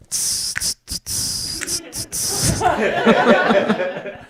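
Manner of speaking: snake-like high wheezing laugh; slithery, strung-out high cackle; high hissing laugh. Pretend. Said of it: "my impression of a snake on heroin".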